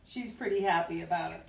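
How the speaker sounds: ordinary delivery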